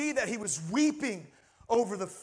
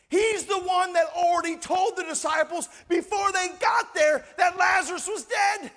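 ordinary delivery

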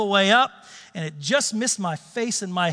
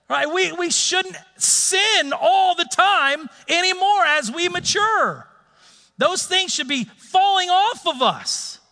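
fired-up preaching; 7 semitones higher than usual. Real, not acted. Pastor drives home the lesson to his congregation, fired-up preaching. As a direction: preach it out high and strong, slowing for emphasis.

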